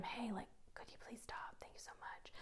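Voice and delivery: hushed, whispered polite correction. Shown as a demonstration. Teacher quietly correcting one student up close, whispered. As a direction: whisper it privately, very softly. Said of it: "like, whisper to them".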